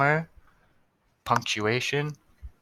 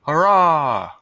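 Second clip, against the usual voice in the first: higher, much louder hurrah; cheer; 6 semitones higher, 10 dB louder than usual. Acted. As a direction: cheer the ending out loud. Cheering at the story's end.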